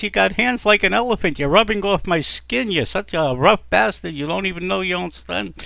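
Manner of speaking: high-pitched nagging wife; berating complaint. Acted. Wife berating husband in acted quarrel.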